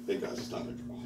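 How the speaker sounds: quiet whispered exit. Retold or imitated. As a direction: hush it so nobody hears. Sneaking out after the fight.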